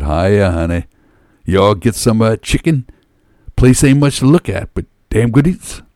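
a hint of a chatty football fan; lightly voiced friendly fan. A narrator's reading. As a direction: greet her like a friendly local fan. Voicing the friendly Eagles fan.

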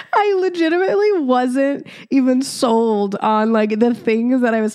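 real laughter breaking through the words; can't hold back the giggles; laughing. Real, not acted. Recalling a cringy moment to her listeners, laughing helplessly. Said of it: "I can't stop laughing".

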